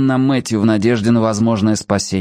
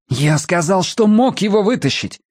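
insistent protest; 9 semitones higher than usual. Acted. Matthew insists, upset.

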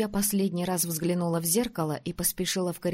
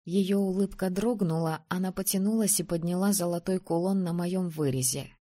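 ordinary delivery

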